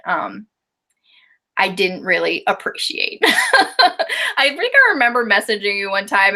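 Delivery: usual speaking voice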